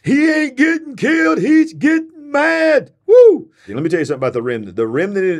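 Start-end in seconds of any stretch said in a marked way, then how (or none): 0.0-2.9 high, excited echo of the film quote; thrilled imitation of the movie voice; echoing the movie line. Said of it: "Let me just show it to you". 3.1-3.5 excited whoop. Real, not acted. Celebrating the movie clip.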